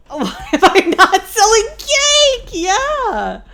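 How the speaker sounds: high, loud and slow, laughing till crying; helpless teary laughter, real; laughing hard. Real, not acted. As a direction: break down laughing mid-question. Cracking up over no cake.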